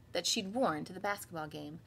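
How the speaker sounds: usual speaking voice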